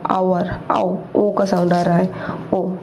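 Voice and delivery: ordinary talk, nothing marked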